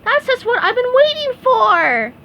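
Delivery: excited. A narrator's reading. SpongeBob thrilled about parcel.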